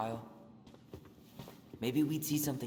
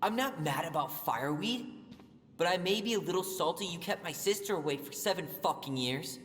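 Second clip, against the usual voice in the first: higher, sarcastic and cutting; bitter anger; 6 semitones higher than usual. Acted. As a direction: throw his resentment back at him. Heated argument with uncle.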